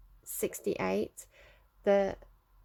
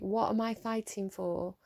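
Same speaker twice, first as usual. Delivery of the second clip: ordinary talk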